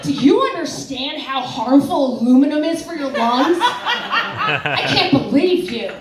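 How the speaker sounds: high, indignant mock scolding, slowing at the end; mad mother. Comic plays her nurse mom telling her off, mock mad. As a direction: scold like an outraged mom, pitch climbing. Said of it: "She's mad".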